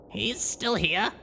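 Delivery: nervous disbelief; young mare voice. Acted. Blackjack nervous.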